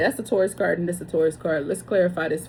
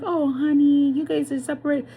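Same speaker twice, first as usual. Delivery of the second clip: higher, mock-sweet "oh honey" voice; sing-song fake sympathy, pitched up; mock consoling friend; 8 semitones higher than usual. Mocking the friend who spilled the tea, in a high, mock-sweet voice. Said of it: "She was like".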